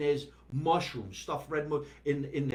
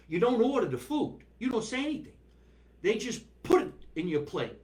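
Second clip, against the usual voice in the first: excited; 8 semitones higher, 3 dB louder than usual. Real, not acted. Excited description of Italian deli.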